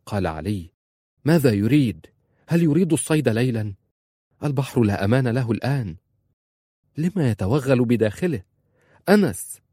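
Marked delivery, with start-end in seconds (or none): 1.2-8.4 rapid nonstop chatter. Acted. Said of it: "علي يتكلم بلا توقف". 9.1-9.6 shouting a name. Acted. Calling out to brother at sea.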